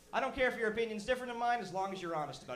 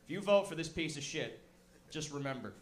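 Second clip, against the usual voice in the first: threatening president; 7 semitones lower, 6 dB quieter than usual. Retold or imitated. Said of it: "I would have come out and said".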